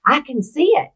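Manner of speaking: high, rising cry of wonder, retold; awed exclamation. Daughter retelling her dying father's vision, awed exclamation. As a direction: imitate someone reaching up, pitch lifted. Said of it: "he's reaching up and he's going".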